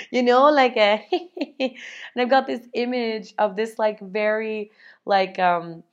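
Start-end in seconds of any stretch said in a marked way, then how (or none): none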